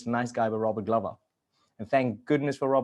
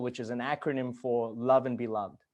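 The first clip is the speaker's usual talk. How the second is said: ordinary delivery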